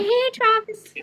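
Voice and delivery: a quick playful imitation of a pop star calling out to her boyfriend. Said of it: "I'm just [name] going".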